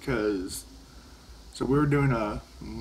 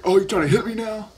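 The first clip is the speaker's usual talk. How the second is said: high, loud mock-outraged teacher; mock teacher accusation; 10 semitones higher, 8 dB louder than usual. Teacher accusing the student of trying to hit him, high and loud. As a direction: play the teacher feigning alarm. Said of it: "he's like".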